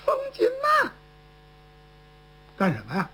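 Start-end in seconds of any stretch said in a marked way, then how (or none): 0.1-0.9 a piercing high call; shrill shout. Acted. Woman thief shouting for her partner: high piercing call. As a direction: scream the name out high. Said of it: "立刻就喊上了".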